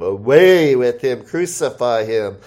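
loud cry that eases off; crowd crying out. Acted. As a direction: cry out like an angry crowd, then ease. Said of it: "they cried out".

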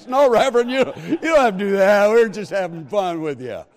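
loud, laughing, quick; laughing reassurance. Retold or imitated. Officers laughing, telling the reverend it's a joke. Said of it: "busted out laughing".

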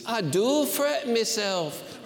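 put-on Scottish accent. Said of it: "kinda do one".

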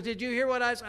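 high, loud imitation of a wife; nagging challenge. Wife asks husband whether he heard her, nagging challenge.

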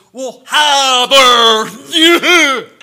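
mock Southern accent. Preacher mocking a Yankee actor's bad Southern accent, hammed-up fake Southern accent. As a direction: stretch every word out like a Yankee faking a Southern accent. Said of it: "Yankee try to give a Southern accent".

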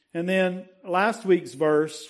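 ordinary delivery

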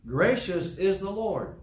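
preacher hammering the verse; slow emphatic preaching. Acted. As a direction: proclaim each word slowly. Preacher stresses key verse.

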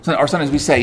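ordinary delivery

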